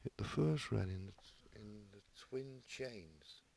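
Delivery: very soft, hushed snooker commentary, slowing at the end; Ted Lowe impression. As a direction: murmur it low like a whispering commentator. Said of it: "I did it like Ted".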